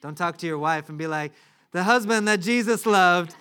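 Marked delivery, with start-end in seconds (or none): 1.7-3.3 loud, slow, high grand announcement; mock self-important. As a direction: proclaim your own title pompously, hammed up. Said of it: "Don't refer to yourself like that".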